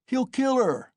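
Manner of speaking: very high cry from an onlooker; crowd shout. A narrator's reading. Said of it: "was shouted round him".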